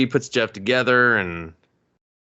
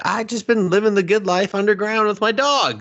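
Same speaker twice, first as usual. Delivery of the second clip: put-on high, whiny put-upon old inventor; mock Finch; 12 semitones higher, 4 dB louder than usual. Finch whining at his friend to leave him alone. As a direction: squeak it out like a cornered hermit. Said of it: "He's like".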